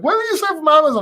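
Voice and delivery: high-pitched mock exasperation; retold protest. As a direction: act out a baffled complaint, pitched right up. Voicing his retort to Amazon returns.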